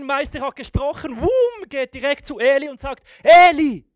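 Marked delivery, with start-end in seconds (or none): 3.2-3.8 loud high call to his master. Acted. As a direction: shout the name across the room. Samuel calling his master.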